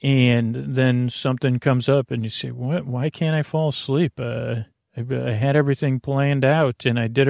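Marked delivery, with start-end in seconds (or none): none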